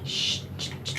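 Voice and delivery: soft unvoiced shushing to settle him; soothing shush. Real, not acted. Groomer calming a nervous dog, soft shushes. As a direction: hush him gently, breath only.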